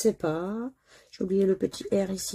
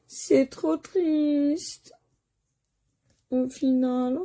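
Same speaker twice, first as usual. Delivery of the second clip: high, slow mock whimper; hammed-up sad moan; 7 semitones higher, much slower than usual.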